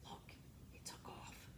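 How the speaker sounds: hushed aside; whisper. Real, not acted. Reader whispers to children as Santa leaves. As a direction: whisper it so the sleigh won't hear.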